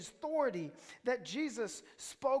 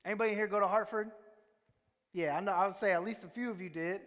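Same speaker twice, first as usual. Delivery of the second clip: ordinary talk, nothing marked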